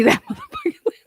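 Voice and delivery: fast, laughing through the words. Real, not acted. Streamer reading a lab report to chat, laughing fast. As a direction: rush it out while cracking up.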